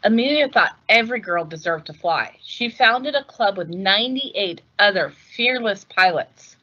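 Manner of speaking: ordinary delivery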